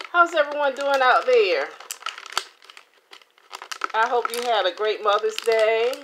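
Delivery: bright, high, loud hello to viewers; excited greeting. Real, not acted. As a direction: call out cheerfully to the audience.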